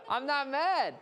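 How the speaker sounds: acted exasperation, building toward a yell; irritated denial. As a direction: deny it with rising irritation. Second, more heated denial in argument.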